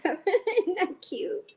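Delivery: laughing, high and slow delight; slow, high-pitched aww over a present; delighted coo. Real, not acted. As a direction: gush over the cute gift. Gift of owl from Tom.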